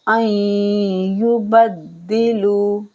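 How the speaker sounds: slow recited word; Quran recitation. Shown as a demonstration. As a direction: chant the word slowly. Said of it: "teacher recites slowly".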